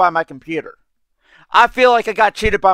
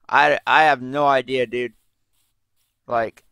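normal talking voice

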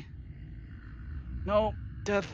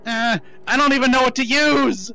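frantic yell; 7 semitones higher, 12 dB louder than usual. Real, not acted. Frantic during attack.

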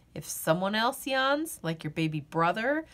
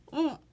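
muffled yawn; 4 semitones higher than usual. Acted. Covering mouth on a yawn.

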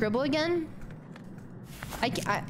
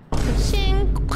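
loud, higher victory cry; excited cheer; 6 semitones higher, 9 dB louder than usual. Real, not acted. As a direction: cheer it like a jackpot win. Celebrating a game success.

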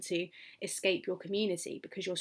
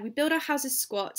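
poem reading, lifted higher and fuller; reading the poem aloud; 6 semitones higher, 3 dB louder than usual. Shown as a demonstration. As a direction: declaim "We build our houses squat" like a resolute islander. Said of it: "We are prepared".

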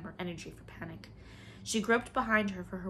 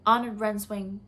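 calling out; 2 semitones higher, 8 dB louder than usual. Acted. Man calling to the woman.

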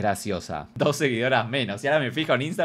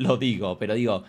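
normal talking voice

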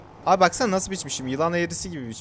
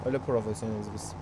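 usual speaking voice

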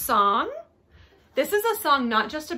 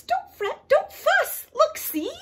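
very high, tiny mouse voice; high-pitched storybook mouse, reassuring; squeaky mouse; 5 dB louder, slower than usual. A narrator's reading. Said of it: "Mouse squeaks".

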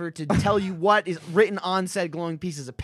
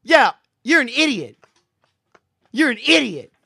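loud, raised scolding; exasperated insult; 7 semitones higher, 8 dB louder than usual. Real, not acted. Co-host calling out friend's silly puzzle, exasperated insult. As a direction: declare it loudly with exasperation.

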